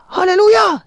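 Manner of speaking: very high, loud desperate hallelujah; high, loud shouted hallelujah. Acted. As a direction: scream it to stop the horse at the edge. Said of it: "Sabi niya".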